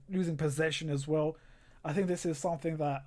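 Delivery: ordinary delivery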